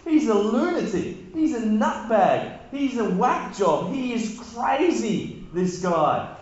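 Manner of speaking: high, scoffing sneer; mocking sign writer. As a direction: scoff like he's calling a madman crazy.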